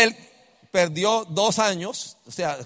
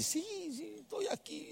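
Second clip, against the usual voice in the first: high, soft girlish voice, mock-flustered; lightly mimicking the stressed dental student; imitating her; 13 semitones higher, 16 dB quieter than usual.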